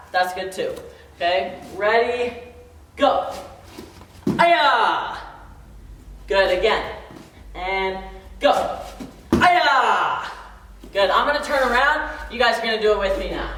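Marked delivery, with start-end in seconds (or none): 4.4-5.2 sharp martial arts kiai yell; kiai shout. Shown as a demonstration. Instructor shouting the kiai with the strike. 9.3-10.3 sharp martial arts kiai yell; kiai shout. Shown as a demonstration. Instructor shouting the kiai with the strike.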